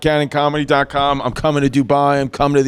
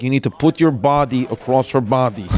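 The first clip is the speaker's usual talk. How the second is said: imitated Russian-accented dentist; 3 semitones lower than usual. Dentist telling a father to hold his daughter down, Russian accent. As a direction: give orders in a Russian accent. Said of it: "this Russian woman".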